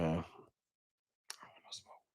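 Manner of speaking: slow, breathy whisper, barely voiced. Shown as a demonstration. Streamer to chat, backing off in a whisper. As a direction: drop to a hush and stretch it out. Repeats line, then whispers it.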